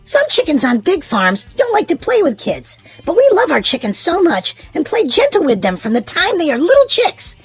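usual speaking voice

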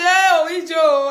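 hammed-up gasp of surprise; mock surprise. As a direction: stretch the oh in amazement. Acting a fan's exclamation.

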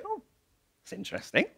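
soft, understated wonder to himself; quiet private realisation. Acted. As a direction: murmur it as a small discovery. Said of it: "I wonder if Thaddeus would just go".

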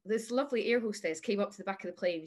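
usual speaking voice

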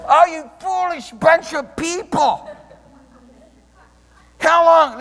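a hint of a chiding preacher; Paul scolding. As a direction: scold them like an exasperated apostle. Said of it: "What a way to talk to the church".

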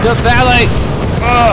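excited shout. Real, not acted. Reaching the exit.

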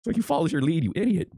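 exasperated squeak. Real, not acted. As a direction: scold like you can't believe it. Exasperated lecture.

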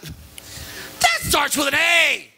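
slow, high, laughing through the words; mock eloquent correction. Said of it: "so very eloquently went".

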